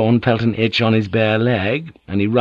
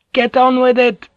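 impatient heckle; 14 semitones higher than usual. Acted. Sailor heckling the storyteller to hurry, high and impatient. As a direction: needle the storyteller to get on.

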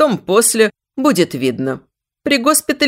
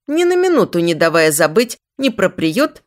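plain reading voice

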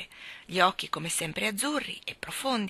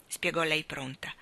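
usual speaking voice

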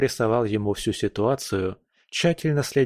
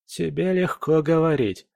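ordinary delivery, nothing marked

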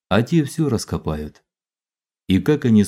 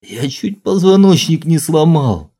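whining; 7 semitones higher, 6 dB louder than usual. A narrator's reading. Injured soldier complains.

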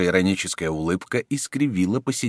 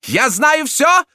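high, loud thundering prelate; much higher and louder, thunder it; 8 dB louder, slower than usual. A narrator's reading. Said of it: "громовым голосом".

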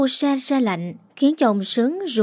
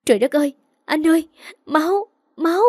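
panicked scream; 7 semitones higher, faster than usual. Acted. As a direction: shriek in horror at the blood. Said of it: "Lan, vợ của Lâm hét lớn lên".

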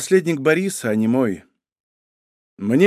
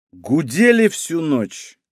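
usual speaking voice

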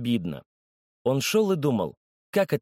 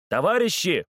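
high, raised call to the crowd; 6 semitones higher than usual. A narrator's reading. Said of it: "закричал судья".

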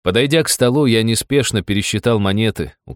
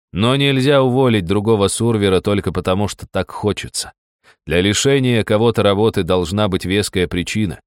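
ordinary delivery

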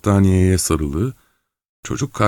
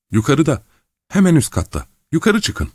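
panicked and high; 9 semitones higher than usual. A narrator's reading. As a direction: voice climbing ever higher in fear. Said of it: "kontrol edemediği bir ses tonuyla".

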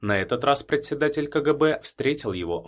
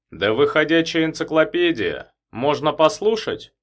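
hidden irony; 7 semitones higher, 5 dB louder than usual. Acted. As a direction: ask with veiled irony. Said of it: "Со скрытой иронией попросил Забелин".

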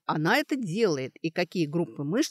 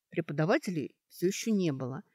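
ordinary delivery

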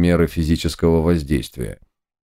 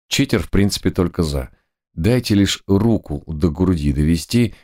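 usual speaking voice

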